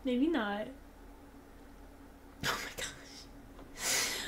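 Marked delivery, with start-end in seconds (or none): 2.4-4.1 slow unvoiced oh my gosh; whisper. Real, not acted. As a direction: breathe it out in disbelief.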